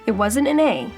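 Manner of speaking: ordinary delivery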